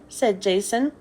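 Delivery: usual speaking voice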